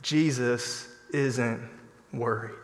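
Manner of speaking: Jesus isn't worried, said quietly and slowly; hushed, slow comfort; soft slow reassurance. Shown as a demonstration. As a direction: drop the voice and reassure gently. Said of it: "I wanna encourage you".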